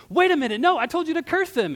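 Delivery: high, loud acted outburst; outraged protest. King Balak hears a blessing instead of curse, loudly outraged. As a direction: burst in with a shrieked objection.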